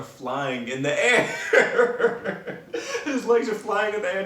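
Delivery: loud, high words breaking into laughter; real helpless laughing. Real, not acted. Storyteller describing friend's flailing legs, cracking up laughing. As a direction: crack up loudly while telling it. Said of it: "I'm laughing as if this was yesterday".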